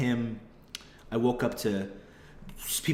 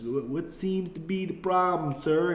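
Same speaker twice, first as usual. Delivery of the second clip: exaggerated rural Southern police accent; country accent; 8 semitones higher than usual. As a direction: play a dim small-town Southern cop. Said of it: "heavy, stupid country accents".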